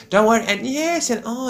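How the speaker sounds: very high, put-on fussing; high-pitched farewell chatter. Friend reassuring the host when leaving, high mock chirp. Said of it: "when you hear the high-pitched voices".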